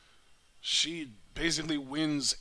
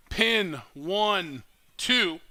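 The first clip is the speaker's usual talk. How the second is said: excited pin count; 5 semitones higher, 6 dB louder, much slower than usual. Real, not acted. Counting the pinfall.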